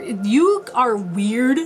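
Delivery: "you are weird" drawn out slowly; stressed "weird". Shown as a demonstration. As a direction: stretch "weird" like air quotes. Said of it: "quotation marks".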